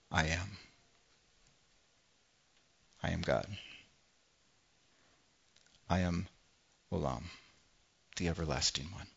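dropping low and soft after the first words; Jesus revealing himself in a low, awed hush; hushed revelation. Acted. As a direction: let the name land in a hush. Climactic I am revelation.

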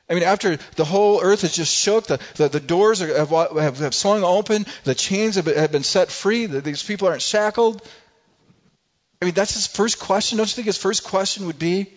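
building amazement at the question; rising excitement. Real, not acted.

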